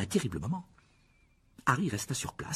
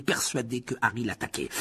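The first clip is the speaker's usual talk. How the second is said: ordinary delivery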